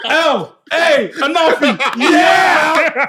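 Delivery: very loud high shout, slow; announcer shout. Acted. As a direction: bellow the name like a ring announcer. Shouting his own promo name.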